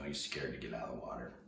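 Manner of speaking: normal talking voice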